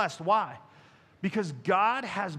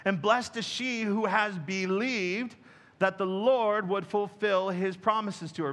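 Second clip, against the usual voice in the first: ordinary talk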